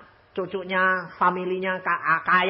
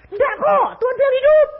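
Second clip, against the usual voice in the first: insistent exclamation; 6 dB louder than usual. Retold or imitated. Uncle insists to the doctor.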